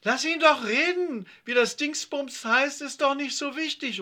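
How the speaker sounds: high, loud impatient cut-in; annoyed interruption. A narrator's reading. Yvonne interrupts the corrector, high impatient protest. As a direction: break in sharply to defend a friend. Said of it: "unterbrach Yvonne".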